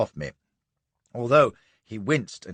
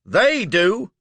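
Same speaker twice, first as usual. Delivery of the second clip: high, loud roar; Southern roaring accusation; 9 semitones higher, 8 dB louder than usual. Acted. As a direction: roar it back. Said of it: "he roars".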